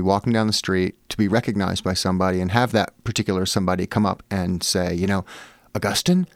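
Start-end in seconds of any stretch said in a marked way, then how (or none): 5.7-6.3 high rising questioning call; a mock stranger's high "is it you?"; tentative recognition. Stranger spots the author on the street, high questioning voice.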